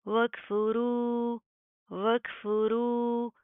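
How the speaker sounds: very slow, drawn-out मद in रू; tajweed recitation. Shown as a demonstration. Teacher reciting slowly for students. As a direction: stretch रू long and heavy. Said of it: "मोटा पढ़ा जाएगा".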